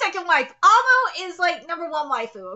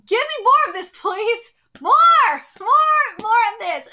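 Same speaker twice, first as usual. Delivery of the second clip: loud, higher, excited plea for more; excited demand; 3 semitones higher, 7 dB louder than usual. Real, not acted. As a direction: beg for more, louder and higher. Excited begging for more art.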